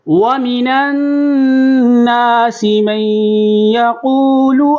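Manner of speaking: slow, high melodic recitation of ختم اللہ علی قلوبہم; model recitation. Shown as a demonstration. Teacher recites so students can listen carefully, slow melodic chant. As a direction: chant it slowly for students to copy. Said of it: "اب ملا کے پڑھیں گے".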